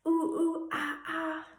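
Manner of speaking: high ooh ooh ah ah; monkey noises. Shown as a demonstration. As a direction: chatter like a monkey. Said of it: "Can you climb up the tree".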